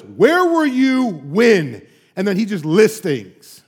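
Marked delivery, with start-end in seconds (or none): 0.0-1.9 very slow, louder challenge; booming God. Retold or imitated. God challenging Job's questions, slow and commanding. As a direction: draw it out with authority. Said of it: "how about this".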